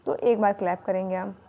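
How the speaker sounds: normal talking voice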